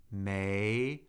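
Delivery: "may" rising steadily; second tone. Shown as a demonstration. Teacher cycling the tones with a syllable, "may" rising. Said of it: "second tone".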